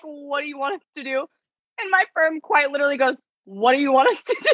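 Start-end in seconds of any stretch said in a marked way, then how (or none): 0.0-1.3 high, hushed mimicry of a baffled firm. Law firm answering a pointless complaint, baffled mimicry. Firm's puzzled reply to caller. 3.5-4.5 higher, quick, laughing quote; laughing mimicry. Retelling the firm's reply to the caller, laughing mimicry.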